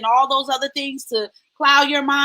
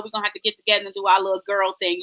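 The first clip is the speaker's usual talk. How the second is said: normal talking voice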